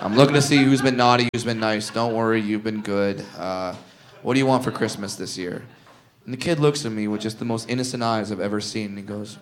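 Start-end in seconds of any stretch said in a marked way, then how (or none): 0.0-5.6 soft, lower, hushed kind elf; gentle elf. Acted. Little person plays a gentle elf to a child, softly. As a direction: tell the kid he's been good, softly.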